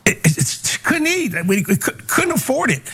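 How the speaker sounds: moved, the voice cracking upward; choked up. Real, not acted. Telling his mother's sacrifice, voice breaking with emotion. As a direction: voice catching high as the memory hits. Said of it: "when I tell this story, it, it touches me".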